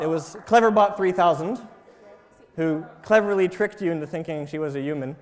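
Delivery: ordinary delivery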